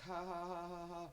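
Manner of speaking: high, quiet mocking "ha ha"; mocking laugh. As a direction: imitate a smug coworker laughing softly. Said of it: "and he's like".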